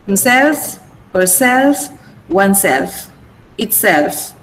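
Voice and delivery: "himself, herself, oneself, itself", weight on each SELF; stress on SELF. Shown as a demonstration. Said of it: "I'm stressing self".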